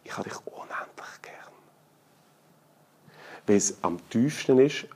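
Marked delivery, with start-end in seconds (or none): 0.0-1.5 stimmlos, nur gehaucht, ganz nah am Ohr; zärtlich geflüstert. Retold or imitated. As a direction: hauche es zärtlich ins Ohr. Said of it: "Und dann sage ich". (Vater flüstert dem Kind Liebe ins Ohr)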